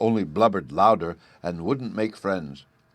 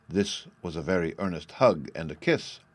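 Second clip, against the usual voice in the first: usual speaking voice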